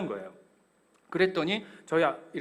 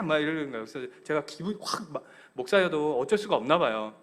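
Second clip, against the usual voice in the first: usual speaking voice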